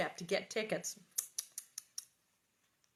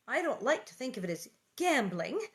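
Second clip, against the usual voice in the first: ordinary delivery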